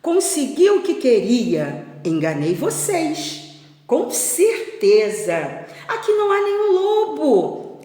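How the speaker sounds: put-on loud, sing-out boyish boast; teasing brag, rising to a high call; acted gloating. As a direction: crow over the trick you played. Pedro gloats over his trick.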